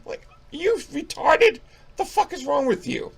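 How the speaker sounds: high-pitched comic outrage; exasperated rant. Pretend. Reviewer berating the character in denial, exasperated. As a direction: squeal it in mock disbelief. Ranting back at the film character.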